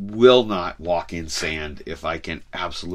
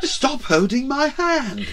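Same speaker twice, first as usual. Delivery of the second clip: high mock quote; slower than usual. Mock quoting Rey.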